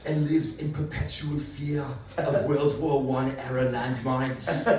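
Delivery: put-on slow, low German-accented narration; Werner Herzog impression. Comic doing a Herzog documentary voice, slow and low. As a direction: drone it like a German filmmaker. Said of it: "like Werner Herzog".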